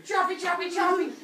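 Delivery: loud, high attack chant; hammed-up high battle yell; manic chopping. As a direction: shout it high with each blow of the blade. Acts out Kronos attacking.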